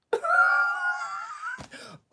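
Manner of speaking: high, drawn-out laugh; real cackle. Real, not acted. Streamer cracking up at own impression, high cackle. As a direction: crack up helplessly.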